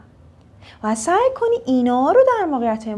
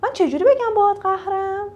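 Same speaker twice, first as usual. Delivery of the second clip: learner's question; 8 semitones higher than usual. Retold or imitated. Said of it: "برو ... بپرس که".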